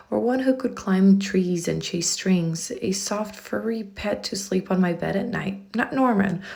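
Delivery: usual speaking voice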